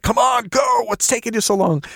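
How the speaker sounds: high-pitched playful heckle across the table; high, impatient mock holler; mock yelling. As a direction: yell it high, as a joking hurry-up. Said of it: "were yelling at each other".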